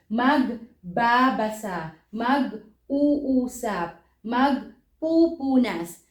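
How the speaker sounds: slow, each part split: mag-ba-basa, mag-uusap, mag-pupunas; march through the repeated syllables slowly. Shown as a demonstration. Teacher drilling future verb forms, slow and separated. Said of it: "we repeat the first syllable".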